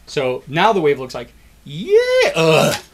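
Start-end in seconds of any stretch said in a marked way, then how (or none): 1.7-2.3 high, loud mock "yay"; hammed-up crowd cheer; wave cheer. Acting out a stadium wave. 2.3-2.8 loud, heavy mock groan; tired groan. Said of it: "we get tired".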